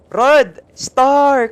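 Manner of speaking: high, loud name call; loud excited shout-out. Real, not acted. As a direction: shout the names across the room. Calling out viewers' names.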